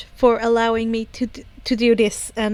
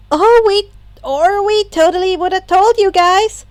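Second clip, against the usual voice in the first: cheerful; 8 semitones higher, 5 dB louder than usual. Acted. Uraraka apologizing cheerfully.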